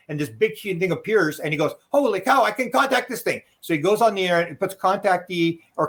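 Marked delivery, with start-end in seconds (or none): 1.9-3.4 high-pitched imitated excitement; retold burst of amazement; amazed. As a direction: jump up high, mimicking an astonished friend. Voicing friend amazed at apparition.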